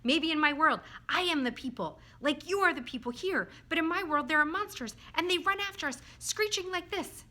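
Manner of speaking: high, loud eager theorizing; excited. A narrator's reading.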